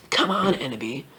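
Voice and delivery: low, soft put-on voice; low coaxing voice. Professor urging Ebony along, low and quiet. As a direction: keep it down low and hushed. First take of character's quoted line.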